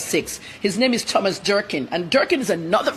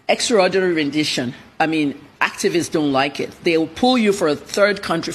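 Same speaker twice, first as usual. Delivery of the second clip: ordinary talk, nothing marked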